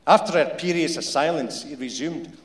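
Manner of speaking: usual speaking voice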